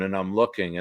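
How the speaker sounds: ordinary talk, nothing marked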